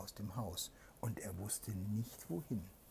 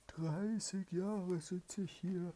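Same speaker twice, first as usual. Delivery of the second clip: pleading spirit; 10 semitones higher, 7 dB louder than usual. Acted. Trapped spirit begs the soldier to free him, high eerie pleading. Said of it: "antwortete eine Stimme".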